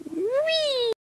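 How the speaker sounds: high gleeful squeal. Acted. Running after the horse, a gleeful squeal. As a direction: squeal with delight.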